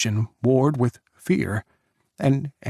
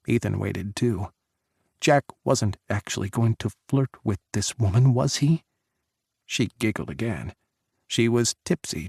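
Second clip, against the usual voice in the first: usual speaking voice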